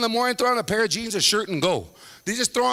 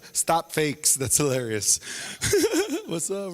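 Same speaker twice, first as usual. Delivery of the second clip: usual speaking voice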